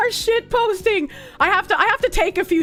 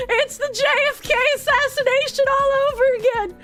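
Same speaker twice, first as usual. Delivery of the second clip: high, giggling, shouted through laughter; laughing; 12 semitones higher than usual. Real, not acted. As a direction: laugh while yelling at the screen. Laughing at game chaos.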